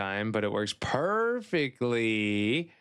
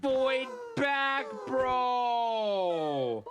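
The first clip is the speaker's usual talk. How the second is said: mock despair; much slower than usual. Mourning lost game character.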